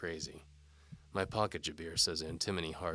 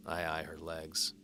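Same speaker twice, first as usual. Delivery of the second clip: usual speaking voice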